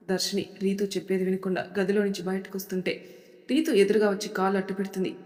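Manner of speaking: ordinary delivery, nothing marked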